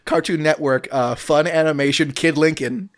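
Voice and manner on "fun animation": ordinary delivery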